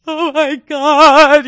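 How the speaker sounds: high, loud, slow sob-wail; imitating sobbing. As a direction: wail it like crying in the car. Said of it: "I'm sobbing, sobbing".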